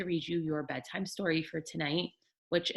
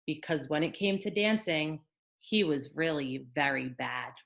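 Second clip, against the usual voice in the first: put-on gentle read-aloud for children; storybook narration for little ones; bedtime story reading; 4 dB louder than usual. A narrator's reading. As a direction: tell it like a picture book at bedtime.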